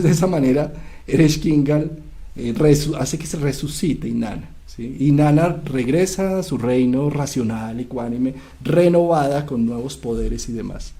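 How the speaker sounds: normal talking voice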